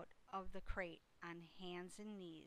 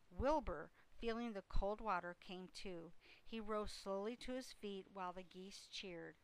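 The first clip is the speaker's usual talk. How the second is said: usual speaking voice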